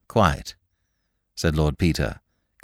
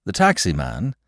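ordinary delivery, nothing marked

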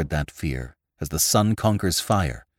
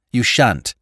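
louder, higher outburst; losing self-control, crying out; 5 semitones higher, 6 dB louder than usual. A narrator's reading. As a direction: cry it out as self-control snaps. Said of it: "his self-control snapped".

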